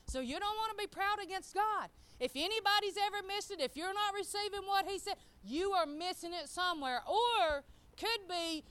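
high-pitched, urgent sermon delivery; emphatic pulpit exhortation; insistent preaching. Real, not acted. Preaches with raised voice.

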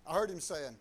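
ordinary delivery

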